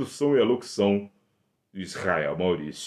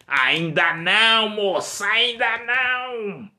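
high, loud taunting character; teasing high voice; 14 semitones higher, 7 dB louder than usual. Acted. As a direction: sing it out, mocking.

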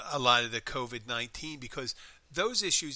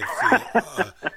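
laughing; 12 semitones higher, 11 dB louder than usual. Real, not acted. Laughs while cutting in.

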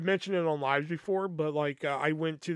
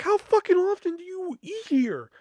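much higher, louder incredulous question; amazed friend; 5 dB louder than usual. Retold or imitated. Friend Jeff turns to him, high amazed disbelief. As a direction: ask it in disbelief.

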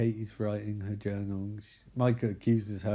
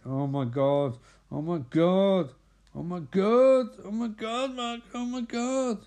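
mock panic climbing higher and higher; mounting panic; 8 semitones higher, slower than usual. [name] reacting while they plan to call for help, mock panicked. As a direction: start near normal, climb to a high drawn-out wail.

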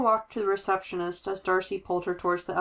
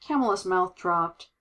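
usual speaking voice

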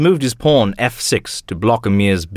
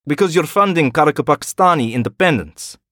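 put-on higher, scornful old colonel; cutting, proud Russian colonel; Ivanov's voice; 7 semitones higher than usual. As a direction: sneer at his failures. Ivanov accuses Emir.

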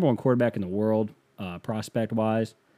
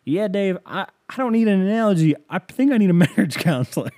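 high, loud, whiny protest; mock-distressed caller with laughter creeping in; exasperated caller; 8 semitones higher, 5 dB louder than usual. Caller rejecting host's analogy, mock exasperation.

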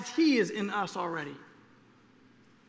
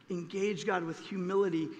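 normal talking voice

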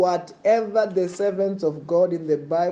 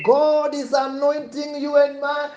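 fiery preaching; 7 semitones higher, 5 dB louder than usual. Acted. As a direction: proclaim it to the congregation.

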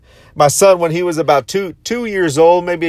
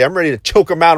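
ordinary talk

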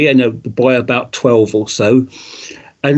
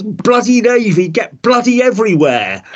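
higher-pitched mock grumble about the Navy; a touch of exasperation, half-joking; grumbling through teeth; 8 semitones higher than usual. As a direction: gripe at the Navy, teeth ground. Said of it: "I ground my teeth as".

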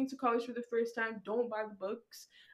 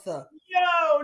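high, loud exclamation; 6 semitones higher, 12 dB louder than usual. Real, not acted. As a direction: shout it in disbelief. Reacting loudly.

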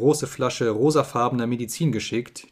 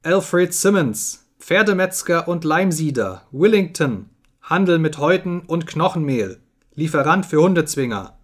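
raised, declaimed reading of a sign; reading aloud; 4 semitones higher, 4 dB louder than usual. A narrator's reading. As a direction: proclaim each item to a silent crowd. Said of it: "inmitten eines tödlichen Schweigens las er".

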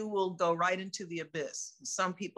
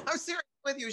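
laughing; 6 semitones higher, faster than usual. Retold or imitated. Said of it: "she said, laughing".

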